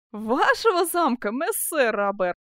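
drawn-out, derisive outburst; mocking, amused. A narrator's reading. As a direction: throw the words back in mock amazement. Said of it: "расхохотался Бенвенуто Челлини".

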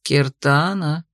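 slow, low, ironic drawl of a name; condescending irony. Acted. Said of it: "снисходительная ирония".

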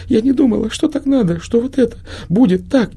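put-on high, trembling girlish voice on the verge of tears; girl near tears. A narrator's reading. The girl explains herself to Prima, high and near tears. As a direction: sound like a child about to cry.

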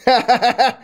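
loud rough soldier laugh; coarse laugh. Acted.

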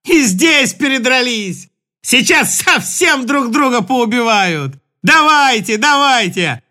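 mocking jeer. Mainat jeering at the brawling Russians. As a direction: egg them on scornfully.

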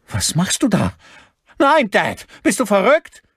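high, loud horrified outcry; alarmed protest. A narrator's reading. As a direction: cry out to stop him. Son alarmed at father's act.

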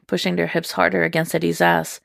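ordinary delivery

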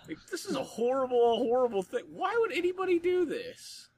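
high-pitched hammed-up indignation; mock outrage. Real, not acted. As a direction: whine it in high comic outrage.

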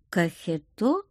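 musing, rising echo; thoughtful. A narrator's reading. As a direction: repeat it as if pondering. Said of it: "задумчиво повторила девушка". (задумчиво переспрашивая)

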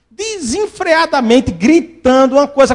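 normal talking voice

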